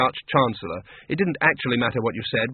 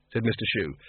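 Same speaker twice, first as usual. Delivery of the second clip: usual speaking voice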